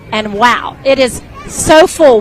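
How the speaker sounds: normal talking voice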